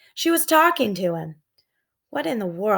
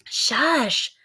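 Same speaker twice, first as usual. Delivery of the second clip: sharp hushing whisper; 4 semitones higher than usual. Acted. Jack hushing Annie so they stay hidden. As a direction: hush her without being heard.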